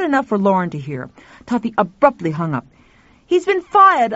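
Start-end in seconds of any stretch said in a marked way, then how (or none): 3.3-4.1 agitated. Acted. Tuffy agitated.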